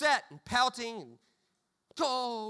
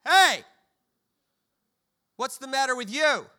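dad calling out; 3 semitones higher, 10 dB louder than usual. Retold or imitated. Dad confronting his pouting son in the car, loud call. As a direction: bark it like a dad at pickup. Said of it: "My dad says".

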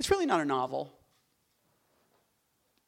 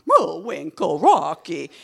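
louder, blunt cartoon Russian man accent; 11 dB louder, much slower than usual. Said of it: "there's a woman one and a man one".

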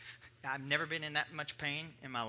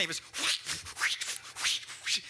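imitated bag breathing; 2 semitones higher than usual.

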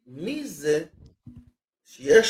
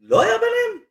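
surprised. Real, not acted. Disbelief at the claim.

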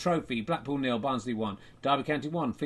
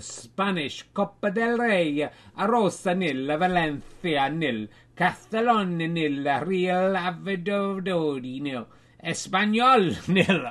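put-on Spanish accent; 3 semitones higher, 4 dB louder, slower than usual. Reading the Spanish Copa del Rey scores.

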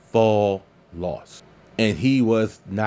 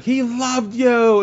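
imitating a grieving wife; 13 semitones higher, 5 dB louder, much slower than usual. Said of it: "she's like".

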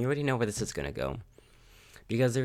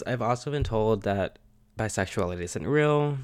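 usual speaking voice

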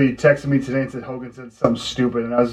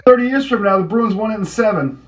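defiant; 6 semitones higher than usual. Retold or imitated. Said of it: "do the Brian Scalabrine thing".

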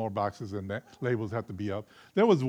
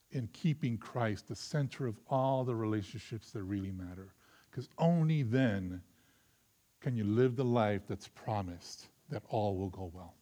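usual speaking voice

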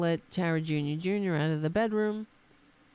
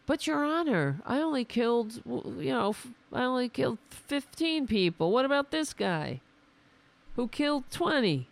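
higher, whiny mock excuse; mock pleading defendant; 6 semitones higher than usual. Mocking a defendant excusing himself to the judge. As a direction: ham up a killer's lame excuse.

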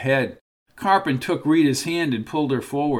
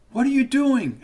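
alarmed; 11 semitones higher than usual. Acted. Rita alarmed at the window.

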